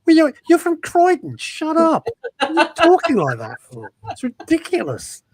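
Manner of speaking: high, scoffing put-down; mock scornful retort. To a Brit back from LA, high mocking scorn. Mocking a Brit's fake accent.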